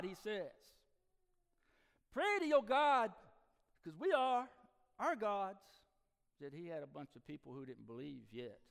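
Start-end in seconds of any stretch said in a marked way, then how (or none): 2.1-5.6 loud, slow, high plea; desperate shout. Acted. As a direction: beg him at full voice. Sailors pleading with Jonah.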